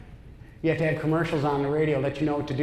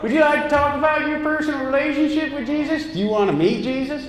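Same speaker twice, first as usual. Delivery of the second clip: high, sugary salesman-of-faith voice; door-knocking missionary; 11 semitones higher than usual. Retold or imitated. Comic mimicking the missionary at your door, high and sweet. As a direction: sweetly pitch your visit a notch up.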